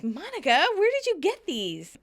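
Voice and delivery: high, exaggerated sitcom question; Rachel asking. Rachel quizzing Monica about cookies, high acted question. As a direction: ask it high and drawn up, playfully. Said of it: "Rachel asks".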